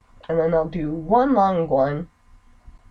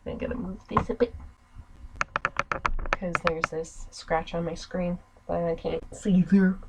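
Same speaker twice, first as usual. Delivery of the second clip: normal talking voice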